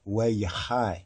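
Weyechai said as weh-yeh-HAI; corrected pronunciation. Shown as a demonstration. Teacher repeating what it really is, corrected pronunciation, Weyechai ending in "hai". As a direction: open Weyechai on W and end on "eye". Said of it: "really it's Weyechai".

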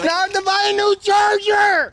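high-pitched outraged yell; upset yelling. Real, not acted. Kid upset over a ruined charger, yelling. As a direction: yell it out in real distress. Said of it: "Hey, don't yell".

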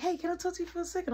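high, fast put-on voice of a friend; high voiced friend. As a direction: squeak it out quickly like the friend. Retold party, someone voiced high.

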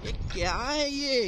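surprised question. Real, not acted. Rider seeing something odd ahead, high surprised question. Startled by sight on the road.

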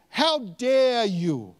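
"How dare you" drawn out slowly and high; emphatic reading. Acted. Laban having a go at Jacob, slow emphatic reading. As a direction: stretch each word of "How dare you" in indignation. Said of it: "gonna emphasize some of the words".

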